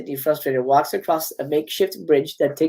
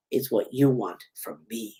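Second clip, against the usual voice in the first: very low, slow, soft menace; Ghostface; 8 semitones lower, 8 dB quieter than usual. Acted. Killer turns Gail's question back on her, low and slow. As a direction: drop deep and take your time. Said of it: "getting a call from Ghostface".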